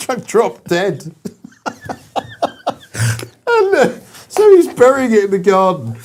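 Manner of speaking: can't keep a straight face; cracking up at the tragic bit; laughing. Real, not acted. Teller laughing at his own story.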